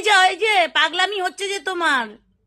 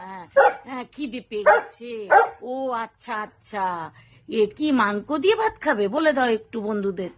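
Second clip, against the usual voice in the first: ordinary talk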